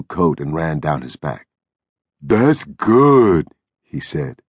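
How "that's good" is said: higher, louder, eager figure voice; simple big man, pleased and eager; childlike Lennie. A narrator's reading. Lennie pleased with the water, eager.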